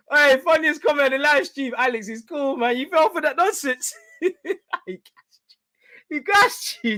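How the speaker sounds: high-pitched words breaking into real giggles; cracking up. Real, not acted. Streamer to the chat about the funniest comment, cracking up. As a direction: tease them while losing it laughing. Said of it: "That was funny".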